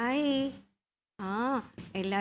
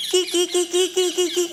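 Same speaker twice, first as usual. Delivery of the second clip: high repeated kee-kee chirps; parrot call; 4 semitones higher than usual. Shown as a demonstration. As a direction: chatter like a parrot in quick short notes. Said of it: "തത്തമ്മ വിളിക്കുന്ന sound കേട്ടോ".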